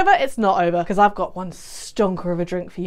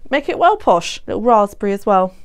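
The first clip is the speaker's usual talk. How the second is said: normal talking voice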